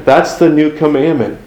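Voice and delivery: normal talking voice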